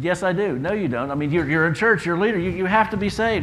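high, loud back-and-forth protest; acted heated argument.

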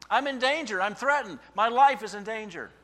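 high, loud, frightened pleading; desperate plea. Acted. Prophet crying to God in fear, high and loud. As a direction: beg for rescue.

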